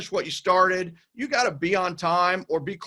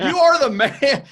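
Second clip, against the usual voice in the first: loud, high, slow and laughing through it; laughing praise; 6 semitones higher, 6 dB louder, slower than usual. Real, not acted. Host laughing as he calls the guest the man. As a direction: crack up while crowning him.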